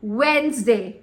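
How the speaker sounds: WEDNESDAY as careful "wenz-day"; two-syllable "wenz-day" for Wednesday; correct pronunciation. Shown as a demonstration. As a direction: say Wednesday properly, silent D. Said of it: "Say it properly".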